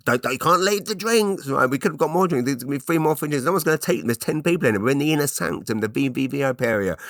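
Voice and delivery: high-pitched, frantic mock pleading; mock panicked insistence. Husband insisting wife keep the free drinks, high mock panic. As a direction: pitch it up and argue desperately.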